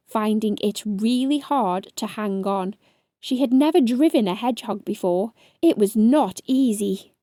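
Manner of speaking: ordinary delivery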